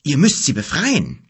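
put-on a boy urging the wizard to act; excited cry. A narrator's reading. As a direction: press him, agitated. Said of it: "rief er aufgeregt".

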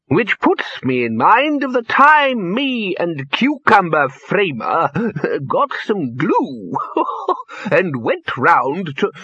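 put-on high old voice with squeaky laughs; high, slow, chuckling old man; chortling old wizard. A narrator's reading. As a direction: another cherished prank, giggled out. Poons starts another tale.

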